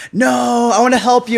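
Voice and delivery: high, loud, pushy insisting; insistent stranger. Retold or imitated. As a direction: press the offer on them, eager. Stranger insists on paying hotel.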